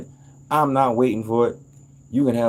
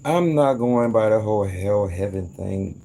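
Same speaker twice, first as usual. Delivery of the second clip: ordinary talk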